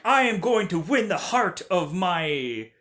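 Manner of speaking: grandly declaimed, hammed-up heroic vow; mock movie line delivery. Hero vowing to win back his betrothed, mock grandiose. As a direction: declaim it emphatically like a pompous hero. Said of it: "how everything has to just be to where".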